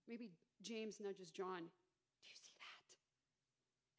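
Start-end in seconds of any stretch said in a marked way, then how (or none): 1.6-4.0 hushed, slow secret whisper; a disciple whispering in the boat. Acted. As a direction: lean in and whisper it. Disciple nudges another in storm.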